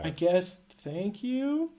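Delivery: slow, high, reluctant questioning thanks; mock grudging thanks. Colonists who hated the player, mock grudging thanks. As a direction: imitate someone forced to be grateful, drawn out.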